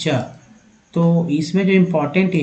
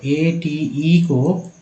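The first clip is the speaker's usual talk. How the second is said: ATE spelled slowly, each letter drawn out; slow spelling; much slower than usual. Shown as a demonstration. Teacher slowly spells ATE for the class. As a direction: spell it out at half speed. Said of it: "एट बोलते हैं".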